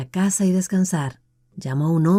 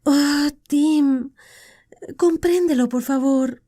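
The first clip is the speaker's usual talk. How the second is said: put-on high, begging voice of a young woman; pleading woman; 8 semitones higher, slower than usual. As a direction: beg him to understand. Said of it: "suplicó Deirdre".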